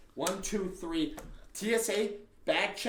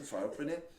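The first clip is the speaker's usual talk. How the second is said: ordinary talk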